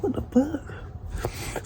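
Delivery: high-pitched mock-incredulous reaction; acted disbelief. Retold startled reaction to taunting.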